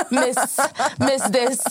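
high, airy posing voice; mocking herself posting a stolen photo, high and airy; posing influencer. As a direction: coo it like a travel post caption. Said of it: "Så jag bara".

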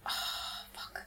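breathless whispered oh fuck; whispered curse. Retold or imitated. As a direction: whisper it in dismay. Imitating TV show character.

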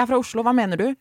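a retold baffled comeback; baffled retort. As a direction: act confused by the question. Retelling her own puzzled reply.

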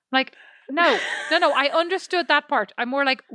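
usual speaking voice

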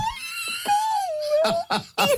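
squealing laugh, close to tears; high laughing fit. Real, not acted. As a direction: laugh so hard it almost cries. Laughing hard at own story. (kimeä naurukohtaus)

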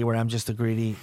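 normal talking voice

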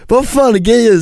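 high, loud exasperated snap; fed-up demand; exasperated Albin. Retold or imitated. (irriterad hög fråga)

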